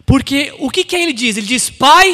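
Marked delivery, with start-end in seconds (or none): 1.7-2.1 loud, high cry; calling out. Acted. Prodigal son addressing his father before confessing, loud call.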